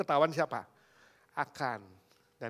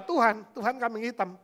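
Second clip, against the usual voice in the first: ordinary talk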